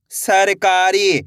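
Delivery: सरकारी drawn out slowly as सर then कारी; slow blended reading. Shown as a demonstration. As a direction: pull सरकारी out slowly for learners. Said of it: "पहले सर पढ़ो". (सरकारी धीरे-धीरे जोड़कर)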